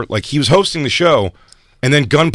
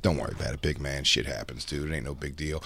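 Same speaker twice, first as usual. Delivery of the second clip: much softer, lower, easygoing consoling; mock reassurance; 8 semitones lower, 12 dB quieter than usual. Host shrugging off the comic's bombed set, softly and low. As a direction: murmur it like a host patting him on the back.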